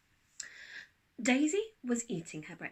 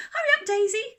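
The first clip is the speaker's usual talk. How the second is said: high, loud hurrying mum; impatient call; 14 semitones higher, 14 dB louder than usual. A narrator's reading. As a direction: call her along impatiently. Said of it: "said Mum".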